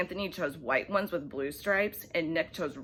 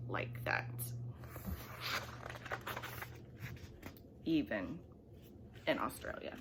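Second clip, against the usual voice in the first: softer, whispered ending; 6 semitones lower, 13 dB quieter than usual. A narrator's reading. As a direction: let the ending fade to a whisper.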